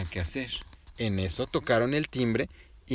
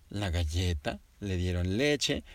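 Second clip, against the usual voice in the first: usual speaking voice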